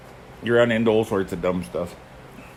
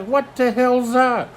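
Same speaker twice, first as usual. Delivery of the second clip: much higher and slow, drawn-out alarm; alarmed exclaim; 4 dB louder, much slower than usual. Retold or imitated. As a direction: stretch it out, still shaken. Snake shot straight at him.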